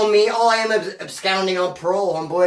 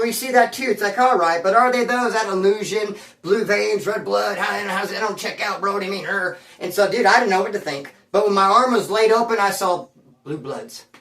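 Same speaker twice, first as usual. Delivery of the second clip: ordinary talk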